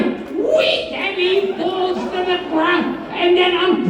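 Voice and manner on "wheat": high squeal at normal volume; high-pitched comic cry; mock yell. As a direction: pitch it way up like a silly yelp. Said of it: "and then I'll yell".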